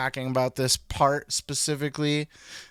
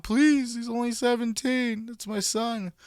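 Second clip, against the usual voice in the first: pleading father; 8 semitones higher than usual. Retold or imitated. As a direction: beg like a frantic father at ringside. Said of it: "begging and pleading from the crowd".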